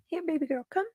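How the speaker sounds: sweet high voice to lure the cat; coaxing the kitty in high tones; cat-calling voice. Real, not acted.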